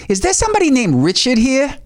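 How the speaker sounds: intense, high-pitched diva impression; high mock-diva voice. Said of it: "it's so intense already".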